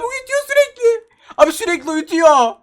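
high, loud, stretched-out whine; whiny frustrated wail. Real, not acted. Gamer whining about constant stuns, high wail. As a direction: wail it like you're at your wit's end.

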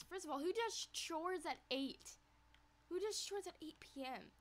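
high, loud disbelief at the chores; incredulous. Real, not acted. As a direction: scoff at doing chores at eight. Mocking friend's late chores.

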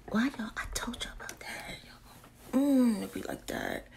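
soft unvoiced whisper; whispered aside. Real, not acted. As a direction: lean in and whisper to the viewers.

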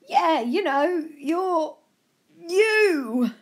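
slow, insistent hint of an old gent. A narrator's reading. Old gent insisting he recognises Joe, lightly voiced and high. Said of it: "the old gent said".

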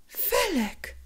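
high, falling, feeble call; a sick mother's weak reproach from bed. A narrator's reading. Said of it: "zawołała matka słabym głosem".

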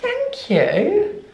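ordinary talk

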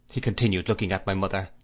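ordinary delivery, nothing marked

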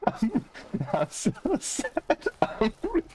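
very high, slow squeaky whine, laughing; squeaky meme voice. Pretend. Gamer repeating the sad-and-real joke in a squeak. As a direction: whine it like a tiny sad gremlin.

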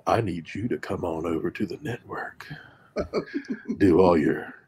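low seductive whisper; sexy velvet teddy bear voice. Acted. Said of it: "sexy velvet teddy bear voice".

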